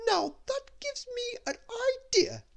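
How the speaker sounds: high, grand lady voice; Little Miss Splendid. Acted. Little Miss Splendid to Mister Grumpy, high grand voice.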